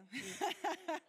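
high, loud burst of laughter; real laugh. Real, not acted. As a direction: burst out laughing at your own aside. Laughs after teasing the audience.

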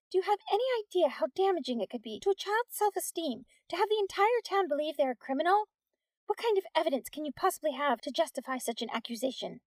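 high, indignant lecture; scold, pitched well up. A narrator's reading. Teacher defending her students to the policeman, indignant.

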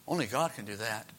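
plain reading voice

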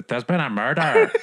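loud, high mock-Scottish proclamation; attempted Scottish. Quoting a famous line to amuse co-host, mock Scottish. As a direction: ham up a dodgy Scottish accent. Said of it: "it's meant to be Scottish".

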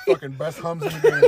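loud, laughing slogan. Real, not acted. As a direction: laugh through the line.

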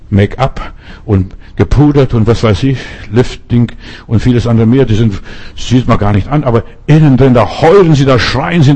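ordinary talk, nothing marked

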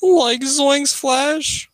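high, drawn-out cartoon voice; Shaggy. Goofing with friends, high slow cartoon impression. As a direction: stretch it out slowly up high. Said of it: "pretty proud of my Shaggy impression".

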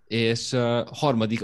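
ordinary talk

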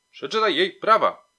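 loud, high insistent yell; forceful, impatient police bark; shouted order. A narrator's reading. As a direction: shout the command at a frozen colleague. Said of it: "krzyknął znowu Smyczek".

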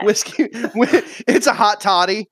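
real laughter breaking up the words; high, loud, cracking up while talking; laughing. Real, not acted. Player teasing about whiskey in the water skin, laughing hard.

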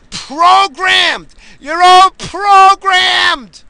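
high, loud outraged shouting; furious rant. Real, not acted. Host railing at a broken system, furious shouting. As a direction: hurl each word out, stretching the key ones.

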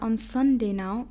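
normal talking voice

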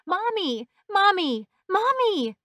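high, loud cries for Mommy; terrified yelling. A narrator's reading. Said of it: "began yelling and crying".

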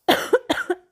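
sudden dry cough; a real throat-clearing cough. Real, not acted. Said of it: "Sorry, ik ga even een".

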